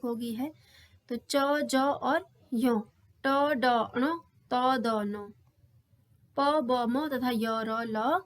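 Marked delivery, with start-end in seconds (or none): 1.1-2.8 च, ज और यो धीरे धीरे अलग अलग बोलें; हर वर्ण साफ़ और धीमा, कक्षा के लिए; धीमे अल्प प्राण वर्ण. Shown as a demonstration. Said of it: "अल्प प्राण". 6.4-7.4 प, ब, म तथा य धीरे धीरे साफ़ बोलें; हर वर्ण को अलग करके गिनाएँ; धीमे अल्प प्राण वर्ण. Shown as a demonstration. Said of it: "ये अल्प प्राण है".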